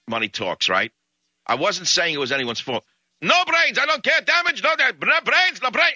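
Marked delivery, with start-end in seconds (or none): 3.2-5.9 very high-pitched mock Russian yelling; screaming crazed Russian. Crazed Russian berates the man parked against his bumper, screaming. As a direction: rant in a frantic, high imitation of an angry Russian. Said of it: "he's on and on with the no brains".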